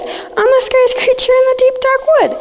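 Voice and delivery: high, bold squeak; squeaky bold mouse. Acted. Mouse boasts to the Gruffalo, high and bold. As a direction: puff up like a brave little mouse.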